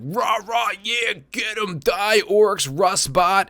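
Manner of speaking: hammed-up cheering, high and loud; mock the gung-ho meme crowd cheering; mocking the hype crowd. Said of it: "and everyone's like".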